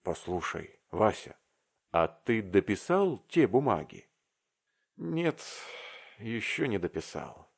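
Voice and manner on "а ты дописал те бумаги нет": ordinary delivery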